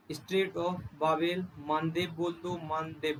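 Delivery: "Strait of Bab el Mandeb" said very slowly; drawn-out teaching pronunciation; slow clear name. Shown as a demonstration. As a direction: spell out the strait name for students. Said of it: "जो आपको पढ़ने में अच्छा लगे वो बोल सकते हो".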